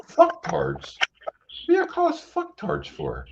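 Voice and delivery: high, incredulous outrage at the insult; indignant disbelief. Real, not acted. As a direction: raise the pitch, offended and baffled. Indignant over being insulted.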